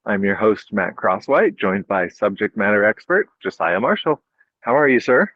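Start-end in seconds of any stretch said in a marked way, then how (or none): none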